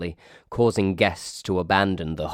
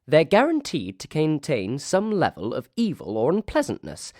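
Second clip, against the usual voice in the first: wench voice; 9 semitones higher than usual. Acted. Wench's voice, narrator reading.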